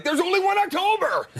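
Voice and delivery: mock announcer shout. The toilet blaring his own ad slogan after every flush, as a mock shout. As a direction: belt it like a toilet that yells the promo. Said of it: "it'd be like".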